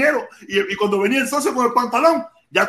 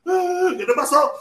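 mock concern; 6 semitones higher, much slower than usual. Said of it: "venían llorando".

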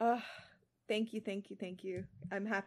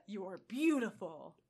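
slow, lightly Australian Thor; a hint of an Australian accent, tender; slower than usual. Reader voicing Thor in a faint Australian accent. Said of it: "still attempting it".

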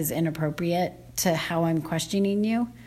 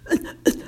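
retold gasp. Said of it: "And she was like".

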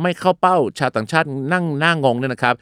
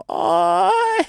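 pained cry. Acted. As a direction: cry out like an overloaded chair. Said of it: "มันคงจะร้อง".

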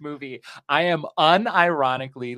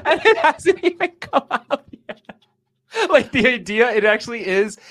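laughing; 11 semitones higher, 7 dB louder than usual. Real, not acted. Laughs about marketing.

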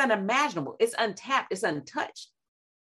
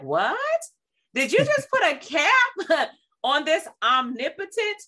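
high, louder, half-laughing disbelief; incredulous outburst; 8 semitones higher, 4 dB louder, slower than usual. Acted. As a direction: scoff at the idea, laughing through it. Mock outrage at limiting God.

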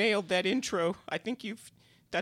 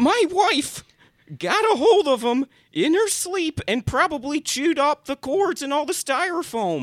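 louder, higher frustrated explaining; exasperated, raised voice; exasperated outburst; 5 semitones higher, 8 dB louder than usual. Acted. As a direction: blurt it out, fed up.

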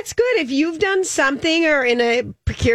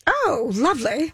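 put-on British accent. Playing along with British accent.

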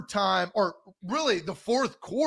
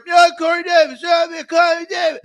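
high, loud dopey cheering; jeering mock shout, pitched way up; mock yahoo fan; 9 semitones higher, 9 dB louder than usual. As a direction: imitate clueless fans hollering their hero's praise. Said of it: "All these yahoos sitting here".

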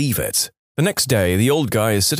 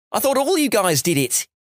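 mock yell; 11 semitones higher than usual. Australian caught by farmhands, flustered mock yell. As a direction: protest as someone caught out. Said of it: "he yells".

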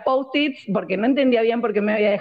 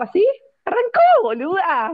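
high-pitched outraged punchline to a friend; retold shock, pitched way up; indignant disbelief; 10 semitones higher, 3 dB louder than usual. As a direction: exclaim it high, reliving the shock. Storyteller exclaims at being abandoned.

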